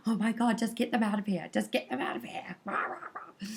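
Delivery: high, loud mock exasperation; mock fed-up outburst, rising into grumbling noises; agitated impression. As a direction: act out a worked-up audience member, hammed-up. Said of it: "were getting really like".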